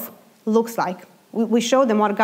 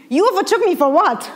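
mock indignant; 8 semitones higher, 4 dB louder than usual. Annoyed at slow driver.

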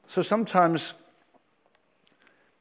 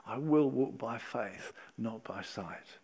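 slow hushed vow; 6 semitones lower, 9 dB quieter, slower than usual. Acted.

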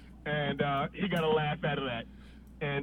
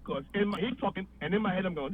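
usual speaking voice

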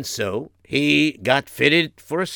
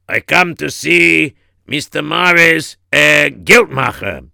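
loud, slow, deliberate announcement; bodyguard visitor; 3 semitones higher, 8 dB louder, slower than usual. Acted. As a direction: announce yourself slowly as a burly visitor.

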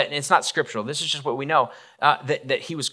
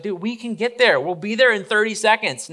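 higher-pitched, mock-urgent disciple; urgent protest; 8 semitones higher than usual. Disciples to Peter as he jumps from the boat, urgent protest. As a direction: plead with a friend to wait, pitched up.

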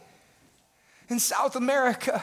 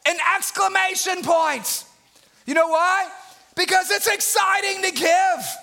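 high, exclaimed pulpit delivery; fired-up preacher in full voice; excited preaching; 9 semitones higher than usual. Real, not acted.

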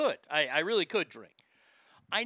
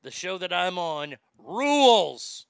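drawn-out mock holler, louder on RULES; retold scream; 6 dB louder, slower than usual. Radio host heckling behind a live reporter, imitated scream. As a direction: imitate a heckler's yell, stretching the last word. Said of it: "right behind him and scream".

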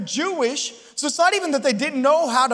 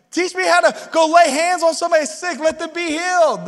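higher, louder excited plea; eager disciples; 6 semitones higher, 5 dB louder than usual. Retold or imitated. Disciples pleading with Jesus for miracle power. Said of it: "No, he said".

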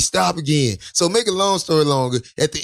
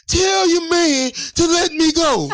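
pleading; 10 semitones higher, slower than usual. Retold or imitated. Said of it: "he tell me".

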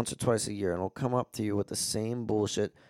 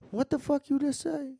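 much higher, mock-indignant squawk; retold indignant reply. The scammer, told to shut up, answers in a squawk of outrage. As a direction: play him as stunned and offended, pitched way up. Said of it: "And he was like".

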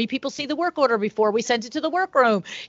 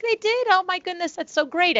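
very high, delighted retelling; acted delight; 10 semitones higher than usual. Salesperson hearing of good reviews, acted delight. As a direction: pitch it way up, gushing at a compliment. Acts delight at retold praise.

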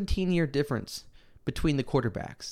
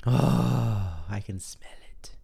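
savoring whisper; 4 semitones lower, much slower than usual. Acted. Savoring the big game.

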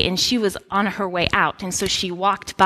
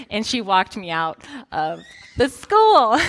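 ordinary delivery

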